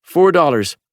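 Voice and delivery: high clerk's voice naming a price; shop woman's voice. A narrator's reading. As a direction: ring him up flatly.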